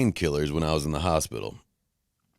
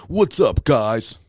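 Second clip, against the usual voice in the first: mock hyped YouTuber "What's up, guys?", much higher and louder; YouTuber greeting; 7 semitones higher, 6 dB louder, much slower than usual. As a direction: ham up the big YouTube intro. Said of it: "when you watch videos on YouTube".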